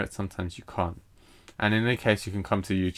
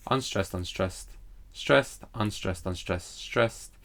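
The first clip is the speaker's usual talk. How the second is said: da-da-DUM beat, "stressed" lifted over two soft words; anapestic stress pattern; 4 dB quieter, slower than usual. Shown as a demonstration. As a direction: chant the foot, landing hard on "stressed". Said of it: "unstressed followed by a stressed long syllable".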